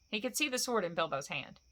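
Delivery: ordinary delivery, nothing marked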